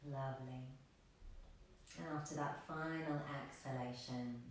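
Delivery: soft, unhurried meditation voice with long pauses; guided meditation. Real, not acted. Yoga teacher guiding extended exhalations, soft meditation voice. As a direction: lead them quietly toward stillness.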